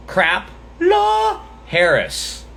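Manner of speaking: "Crapla" said slowly and high, each syllable stretched; drawn-out mocking "Crapla Harris". Shown as a demonstration. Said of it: "That's how he spelled it".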